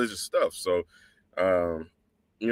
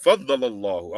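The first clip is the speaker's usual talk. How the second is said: ordinary talk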